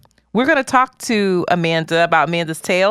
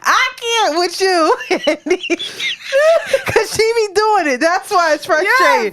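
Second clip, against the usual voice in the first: talking through laughter, pitched up; laughing; 10 semitones higher than usual. Real, not acted. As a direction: giggle the words out.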